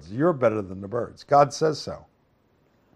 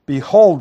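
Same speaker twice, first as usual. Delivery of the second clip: BEHOLD said loud and emphatic; forceful, commanding "Behold"; 4 semitones higher, 11 dB louder than usual. Shown as a demonstration. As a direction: hit "Behold" hard, as a call to attention. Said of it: "it's, it's emphatic".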